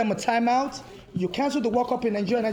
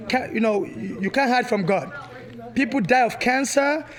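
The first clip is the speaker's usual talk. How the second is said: ordinary talk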